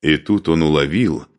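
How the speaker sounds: plain reading voice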